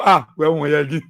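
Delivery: low, chuckling mock reply; mock laughing quote. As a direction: laugh through the quoted words.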